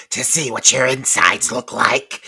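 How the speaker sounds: loud, harsh imitated menace; violent killer threat. Killer answering Casey on the phone, loud violent menace. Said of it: "it's so fucking violent".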